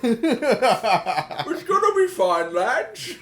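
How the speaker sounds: very slow, loud, laughing Cockney reassurance; Big Earl Cockney. Pretend. Voicing Big Earl for friends, laughing slow Cockney. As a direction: soothe the lads like a Brexit bloke. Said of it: "still on board with Brexit".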